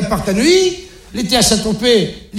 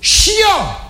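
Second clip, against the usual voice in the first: high, exasperated exclamation; fed-up parent; 10 semitones higher than usual. Acted. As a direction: spit it out fed up. Exasperated about teens.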